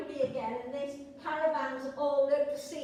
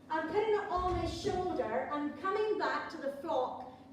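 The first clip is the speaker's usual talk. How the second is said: ordinary delivery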